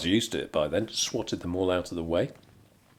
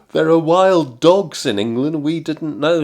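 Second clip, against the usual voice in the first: higher, louder mock panic; panicked staff; 6 semitones higher, 9 dB louder than usual. Baristas reacting to the wild dog story, mock panic. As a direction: blurt it out in alarm.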